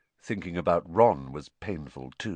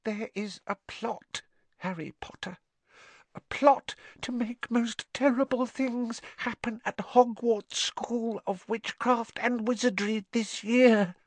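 whispering elf. A narrator's reading. Dobby revealing a plot to Harry, high hushed warning. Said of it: "whispered Dobby".

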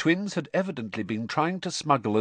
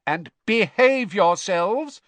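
high, loud, slow parting order; drawn-out motherly warning, called out; calling after them; 10 semitones higher, 8 dB louder, slower than usual. A narrator's reading. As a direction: call sternly to retreating sons. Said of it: "she called after the twins'".

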